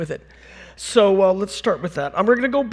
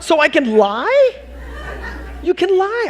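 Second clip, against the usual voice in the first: high, slow, louder with a laugh; savoring the loophole; gleeful realization; 9 semitones higher, 4 dB louder than usual. Retold or imitated. Student thrilled he can fake it, high gleeful voice. Delighted realization at art school.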